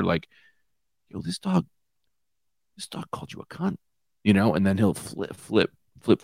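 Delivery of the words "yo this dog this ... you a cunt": breathy whispered taunt in the dog's ear; whispered instigating. Acted. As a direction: hiss it conspiratorially. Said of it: "I'm gonna whisper in the Shih Tzu's ear".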